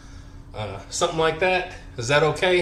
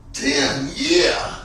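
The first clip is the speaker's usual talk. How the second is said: effortful shout; 3 semitones higher, much slower than usual. Real, not acted. Finishing a heavy set.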